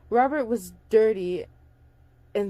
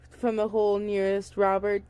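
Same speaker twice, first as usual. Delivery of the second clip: ordinary delivery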